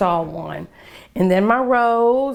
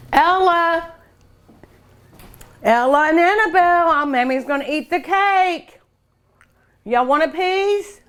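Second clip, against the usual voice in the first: calling out; 8 semitones higher than usual. Real, not acted. Calling the girls in for cake.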